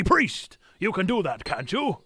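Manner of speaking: ordinary talk, nothing marked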